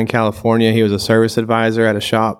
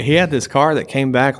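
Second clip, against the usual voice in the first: ordinary talk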